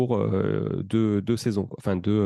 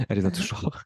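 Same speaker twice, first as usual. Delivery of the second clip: playful jab, a bit higher; teasing aside to wife; 4 semitones higher than usual. Acted. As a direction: tease her with a grin across the table. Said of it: "Je la regarde parce qu'elle est en face".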